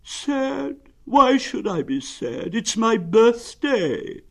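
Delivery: high, slow, aggrieved protest; gloomy donkey. Acted. Donkey telling Pooh it's his birthday, aggrieved. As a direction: draw it out high with wounded pride. Eeyore protests he isn't sad.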